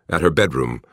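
ordinary delivery

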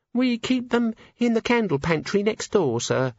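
put-on high, timid servant girl; nervous young maid. A narrator's reading. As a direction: stammer it out, frightened. Maid voiced.

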